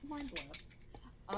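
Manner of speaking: slow, high announcer welcome; show intro. Acted. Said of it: "Take us away".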